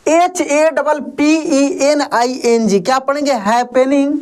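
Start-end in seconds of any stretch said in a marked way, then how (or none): none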